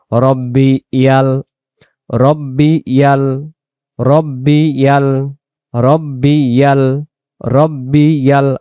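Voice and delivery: slow, drawn-out "রব্বি ইয়াল" with doubled b; correct recitation. Shown as a demonstration. Teacher drilling students on the correct phrase. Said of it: "সহিভাবে সুন্দর করে বলতে হলে".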